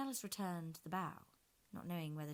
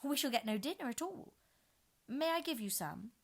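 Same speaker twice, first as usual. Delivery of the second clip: put-on high, louder eager girl; flustered Alice; 8 semitones higher, 7 dB louder than usual. A narrator's reading. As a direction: hurry to offer some. Alice protests, offers pudding.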